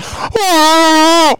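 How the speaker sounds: high, loud choked yelp; high gagging yelp. Retold or imitated. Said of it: "I'm like".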